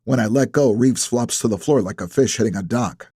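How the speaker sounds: usual speaking voice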